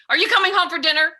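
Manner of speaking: high, loud, fast angry demand; yelling text. Retold or imitated. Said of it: "Which to him was".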